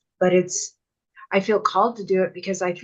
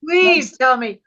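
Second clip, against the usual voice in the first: a loud, high playful dare; playful defiant challenge; laughing dare; 5 semitones higher, 7 dB louder, slower than usual. Retold or imitated. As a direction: dare them to doubt you, laughing.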